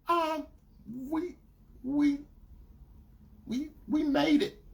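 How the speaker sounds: high, halting imitation; hesitant girlfriend. Girlfriend struggling to admit it, imitated hesitantly. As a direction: stall between words in a high voice.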